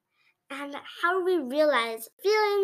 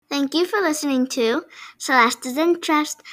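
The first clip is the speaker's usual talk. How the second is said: podcast outro; 7 dB louder than usual. Acted.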